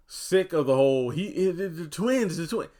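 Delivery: high, loud, stumbling frustration; fed-up exasperation. Real, not acted. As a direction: sputter it out, exasperated. Fed up, breaks off repeatedly.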